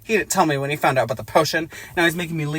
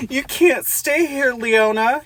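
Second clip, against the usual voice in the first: higher, louder, slow drawn-out yell; 10 semitones higher, 5 dB louder, much slower than usual. A narrator's reading. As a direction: yell it out in anguish. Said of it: "he yells".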